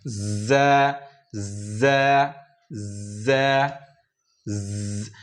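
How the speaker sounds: ज़ held long and buzzing, voiced through the teeth; teacher drilling the buzzing z. Shown as a demonstration. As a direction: let ज़ vibrate with a buzz. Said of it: "थोड़ा झंझनाहट इसमें आएगा".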